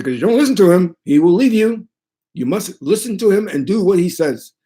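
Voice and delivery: high, emphatic priest impression; imitated priest laying down the rule; high priest's warning. High priest voiced warning him.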